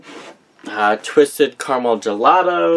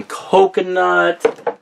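"coconut" with the O's drawn out and emphasized, pitched higher; stress on coconut's O's; 5 semitones higher, much slower than usual. Real, not acted. Vlogger showing off her candle collection, playfully over-emphasizing the O's in "coconut". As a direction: stretch both O's in "coconut", leaning into them slowly. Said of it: "put an emphasis on the O's".

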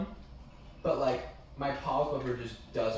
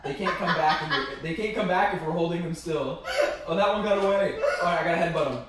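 laughing; 3 semitones higher, 7 dB louder than usual. Real, not acted. Laughing while playing.

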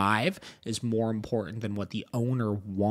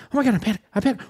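panicked dog voice; 10 semitones higher, 4 dB louder, much faster than usual. Retold or imitated.

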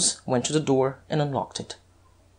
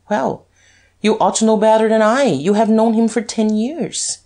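higher, louder, quick offhand remark; careless; 6 semitones higher, 4 dB louder than usual. A narrator's reading. Duke answering the anxious detective, careless. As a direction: toss it off briskly in a raised pitch. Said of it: "Then he said carelessly".

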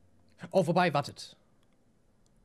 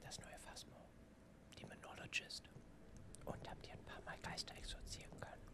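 hushed, breathy whisper throughout; 2 semitones lower, 24 dB quieter than usual. Real, not acted. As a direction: keep it a secret whisper. Keeps whispering to chat. (flüsternd)

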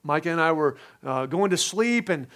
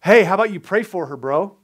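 casual voice of God; 3 semitones higher, 8 dB louder than usual. Retold or imitated. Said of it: "I felt the conviction of the Lord say".

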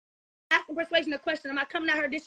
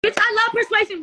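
loud outburst; 5 semitones higher, 7 dB louder than usual. Real, not acted. Outburst defending friend on live.

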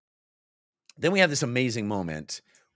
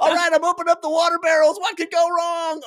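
cocky sailor; 4 dB louder than usual. Acted. Sailor opening barrels cheerfully.

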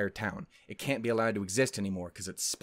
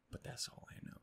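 very soft, unvoiced whisper; barely audible repeat under the breath; whispered aside; 19 dB quieter, faster than usual. Real, not acted. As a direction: whisper it as a tiny afterthought. Hushed repeat of the line.

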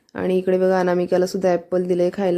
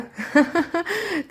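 short high-pitched laugh; 9 semitones higher than usual. Real, not acted. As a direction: laugh up high at her antics. Laughs at the girl.

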